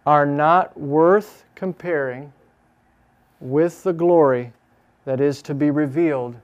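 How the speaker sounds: slow, emphatic, word by word; dictating while writing. Shown as a demonstration. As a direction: spell the verse out slowly and firmly. Saying the verse while writing it.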